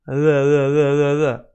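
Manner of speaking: the said with tongue hovering, touching nothing. Shown as a demonstration. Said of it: "Just be hovering. Neither".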